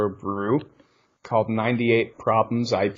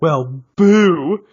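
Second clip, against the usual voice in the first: ghostly boo, raised and loud; startling boo; 6 semitones higher, 8 dB louder than usual. Pretend. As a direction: jump out with a spooky boo. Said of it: "and be like".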